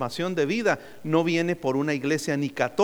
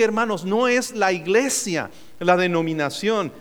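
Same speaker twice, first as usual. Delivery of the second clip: ordinary talk, nothing marked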